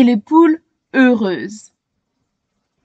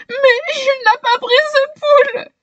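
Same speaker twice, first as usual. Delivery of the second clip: sobbing; 14 semitones higher than usual. A narrator's reading. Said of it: "sanglote-t-elle".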